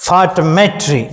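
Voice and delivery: Photometry with the weight on మె; stress on met. Shown as a demonstration. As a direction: lean into మె in photometry. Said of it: "మెట్ మీద ... వస్తున్నది stress". (మెట్ మీద ఒత్తిడితో photometry)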